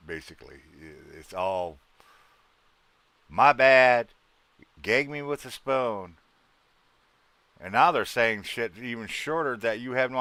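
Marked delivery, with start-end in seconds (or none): 3.3-6.2 put-on high, loud valley girl drawl; mock a Californian valley girl; hammed-up valley girl voice; valley girl impression. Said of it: "they just talk like".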